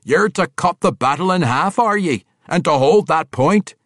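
loud, high, boisterous dwarf bellow; a hearty dwarf calling out in delight; gruff dwarf. Acted. Boisterous dwarf Athrogate greeting his king, loud and gleeful.

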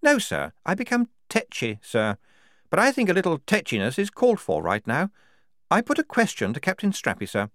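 high-pitched, insistent officer; tetchy major. Acted. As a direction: answer back tetchily to a superior.

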